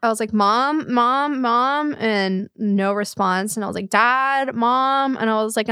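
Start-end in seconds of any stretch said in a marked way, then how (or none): 0.3-1.9 retold calling out. Calling for parents at night. 3.9-5.2 retold calling out. Calling for parents at night.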